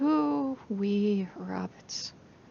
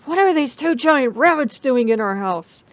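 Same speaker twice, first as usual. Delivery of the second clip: high, loud demanding question; indignant shout; 5 semitones higher, 11 dB louder than usual. Acted. Woman demanding Loki explain the giant rabbits, loud. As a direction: scold him at full volume.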